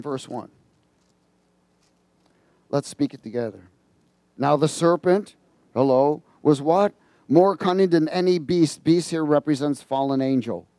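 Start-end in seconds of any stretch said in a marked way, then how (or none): 4.4-8.8 scripture reading. Acted. Reads Genesis with congregation.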